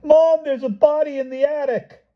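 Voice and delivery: put-on high, loud child's yell; boy's shrill yell to his mother. A narrator's reading. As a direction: yell it across the party, a frantic boy. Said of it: "He yelled it".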